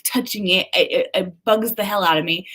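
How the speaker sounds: ordinary talk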